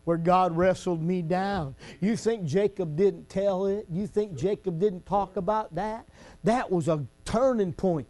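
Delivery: ordinary talk, nothing marked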